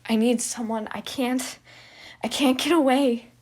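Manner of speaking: loud, rising, pleading cry; desperate plea. Acted. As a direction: beg with all he has.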